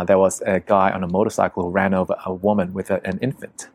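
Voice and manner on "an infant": usual speaking voice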